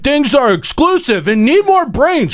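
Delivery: high, slow mocking read of chat. Shown as a demonstration. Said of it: "in the chat saying".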